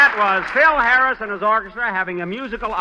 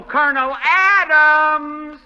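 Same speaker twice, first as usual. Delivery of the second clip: loud, higher, slow call across the base; 10 semitones higher, 4 dB louder, slower than usual. Real, not acted. Jack calling out for the colonel to complain. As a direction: draw the name out and call for the colonel.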